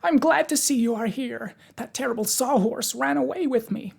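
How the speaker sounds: high, delighted character voice. A narrator's reading. Pumpkinhead telling Tip of the runaway sawhorse, delighted. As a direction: pitch it way up, glad to see them. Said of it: "he cried delightedly".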